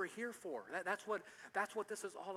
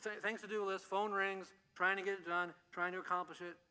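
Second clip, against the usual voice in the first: ordinary delivery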